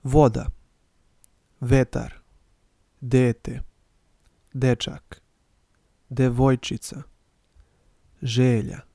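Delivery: each Serbian word spoken clearly for learners to repeat; teacher's model reading; slow model reading of Serbian words. Shown as a demonstration. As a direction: read every word slowly and clearly, pausing after each. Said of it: "I'll read them and you can repeat".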